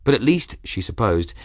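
plain reading voice

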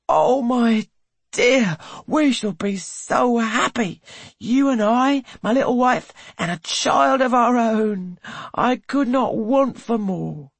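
he can hardly contain his delight; overjoyed husband. A narrator's reading. Husband rejoices at child news.

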